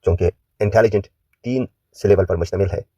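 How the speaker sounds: ordinary talk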